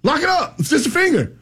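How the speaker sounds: high-pitched self-scolding; worked-up, high frantic rant; retold frantic yelling. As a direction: yell at yourself in a high, panicky pitch. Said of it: "I can't stop yelling".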